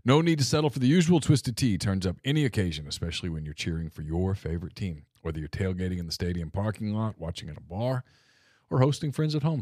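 upbeat sponsor read. Acted. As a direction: sell it like a radio ad. Reads a sponsor ad.

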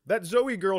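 ordinary delivery

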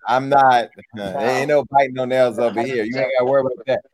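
talking around a retainer in the mouth; words slightly thick from a retainer; wearing a retainer. Real, not acted. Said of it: "I put my retainers back in".

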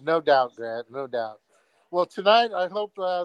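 usual speaking voice

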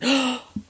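sharp intake of breath; shocked gasp. Retold or imitated. Customer hears her glasses called auntie glasses, shocked gasp. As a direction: gasp as if insulted.

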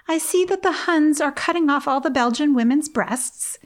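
cheerfully chatty about something grim; breezy as though sharing good news; pleasant remark. A narrator's reading. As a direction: read it with a light, pleasant tone. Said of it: "she would remark pleasantly".

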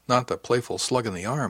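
ordinary delivery, nothing marked

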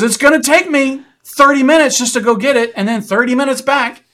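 high, loud across the water; ranger yelling. Retold or imitated. Ranger shouts the timing across the raging river. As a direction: shout the details over the river.

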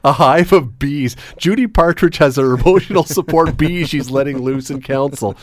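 laughing through the words; amused joking. Real, not acted. Host imagining bees at City Council, amused and laughing. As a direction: giggle your way through a silly scenario.

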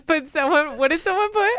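laughing. Real, not acted. Laughing after caption joke.

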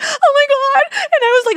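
high, loud panicked outburst; frantic. Retold or imitated. Traveler at the check-in counter, frantic. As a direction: blurt it out in a mock panic. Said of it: "so frantic 'cause I remember".

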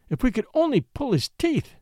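a hint of plaintive longing. Worried woman wishing aloud, mildly plaintive. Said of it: "she went on plaintively".

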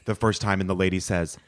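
ordinary talk

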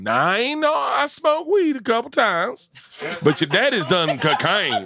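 hammy hillbilly woman impression; Leanne impression. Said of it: "this is my impression of Leanne".

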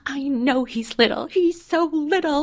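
put-on high, drawn-out child's whine of annoyance; put-on small child voice, high and exasperated; exasperated little kid. Voicing four-year-old's exasperated outburst.

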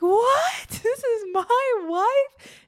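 high, loud, laughing disbelief; so excited. Retold or imitated. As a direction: imitate a drugged husband's thrilled astonishment. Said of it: "he gets so excited".

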